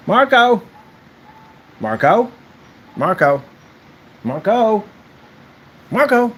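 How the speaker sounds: high-pitched Marco Polo call; playful calling out. Pretend. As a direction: call for someone out of sight, in play.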